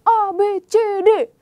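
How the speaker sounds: loud, high mock yelling; a child shouting at mother; hammed-up bratty yell; child snapping at mom. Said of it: "bentak-bentak".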